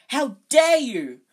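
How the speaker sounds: high, slow outraged shriek. A narrator's reading. Alicia outraged at the insult, a slow piercing shriek. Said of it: "Alicia shrieked".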